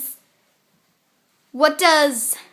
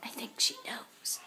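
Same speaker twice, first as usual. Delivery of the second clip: whispered aside; 3 semitones lower, 18 dB quieter than usual. Real, not acted. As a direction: whisper it to the camera. Aside to camera, unvoiced.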